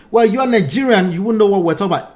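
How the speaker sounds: ordinary talk, nothing marked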